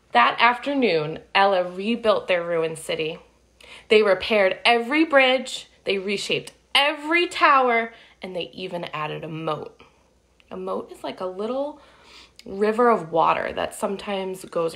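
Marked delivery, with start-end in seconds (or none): 0.1-9.8 put-on lively read-aloud for kids; storybook narration. A narrator's reading. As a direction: tell it like a picture book to children. Reading picture book aloud.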